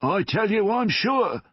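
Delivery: high, loud, certain; loud insistence. A narrator's reading. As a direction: insist at full voice. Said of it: "heard a loud voice".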